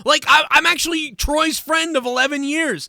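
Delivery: high and loud, building indignation; riled-up complaint to his co-hosts; worked-up rant. Real, not acted. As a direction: push it up, incredulous.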